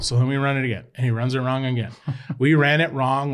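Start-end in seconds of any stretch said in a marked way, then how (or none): none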